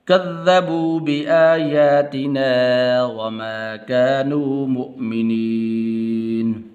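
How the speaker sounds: melodic chanted recitation; Quran recitation. Real, not acted. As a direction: chant each verse slowly with melody. Teacher recites Quran verses.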